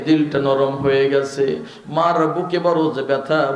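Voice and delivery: ordinary talk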